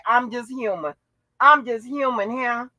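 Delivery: ordinary talk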